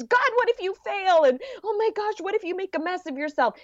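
a nervous friend fretting that you'll fail; fretful inner critic. Retold or imitated. Inner critic warning you off risk, high fretful voice. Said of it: "this voice that's going like".

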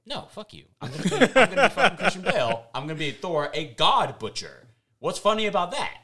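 imagined Bale scoffing at jokes, hammed-up and laughing; mock Bale rant. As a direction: ham up an indignant Bale. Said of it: "And Taiki said".

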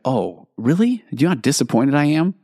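mock disappointed parent scolding teen; sarcastic disappointment. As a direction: imitate a let-down parent scolding. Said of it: "you're like".

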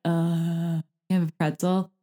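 soft, indifferent mock reply; unimpressed kids. Children answering their gushing mother, quiet disinterested voice. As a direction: mumble it as if not listening. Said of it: "they were like".

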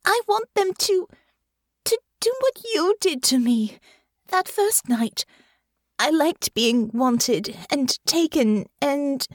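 high, anxious lady's voice, slowing at the end; anxious young lady. A narrator's reading. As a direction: voice Honor as a nervous young noblewoman. Narrator voices Honor.

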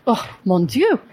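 put-on slow, lilting French-accented viticulturist. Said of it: "Does she do that imitation a lot?".

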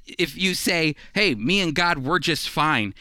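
usual speaking voice

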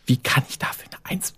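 soft breathy disbelief; incredulous whisper. Retold or imitated. Student rereading her paper, softly whispered disbelief. Disbelief at own good grade.

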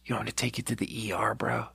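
hushed, quick, breath-only question; sigh, then whisper it under your breath; weary whisper. Retold or imitated. Reluctant helper to a collapsed man, weary whisper.

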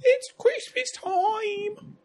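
high, slow wailing goofy voice; crazy voice. Retold or imitated. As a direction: drag it out in a whiny shout. Sandler crazy voice impression.

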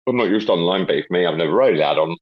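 usual speaking voice

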